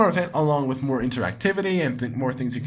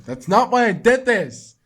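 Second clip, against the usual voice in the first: loud, slow, higher yell; defensive shout; 8 semitones higher, 8 dB louder, slower than usual. Real, not acted. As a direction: shout her down. Yelling back at Monika.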